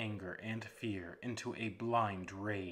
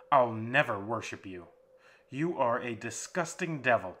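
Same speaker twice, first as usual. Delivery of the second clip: louder, firm defiance building; new conviction; 4 dB louder than usual. Acted. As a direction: stand firm against your tormentor. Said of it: "with a new conviction in his voice".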